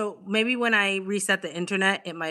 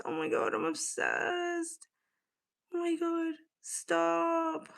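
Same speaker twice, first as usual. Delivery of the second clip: high, soft adoring; high, soft, adoring murmurs; soft breathless gush; 8 semitones higher, 8 dB quieter, slower than usual. Real, not acted. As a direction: melt over the picture. Gushing softly at photo.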